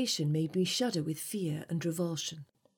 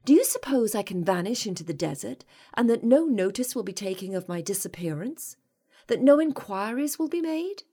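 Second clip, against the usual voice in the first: raised, higher indignant questioning; growing panic; 4 semitones higher, 6 dB louder than usual. A narrator's reading. Captive woman challenging her captor in growing panic. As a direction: build to a high, loud, alarmed question. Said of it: "I lashed out in growing panic".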